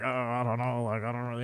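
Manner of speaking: low, soft, hesitant mumble; trailing, unsure and hushed; mock reluctance. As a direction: imitate an uneasy guy backing off quietly. Acting out his reluctant reply.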